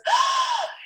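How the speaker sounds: high, sharp intake of breath; excited baby gasp. Acted. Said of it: "And the baby goes".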